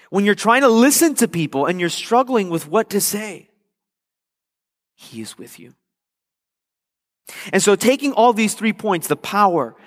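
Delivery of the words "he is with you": very soft, low refrain; hushed reassurance. Real, not acted. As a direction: drop right down, almost to nothing, to reassure. Hushed refrain ending the preacher's list.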